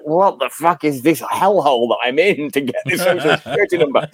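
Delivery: exasperated rant. Retold or imitated.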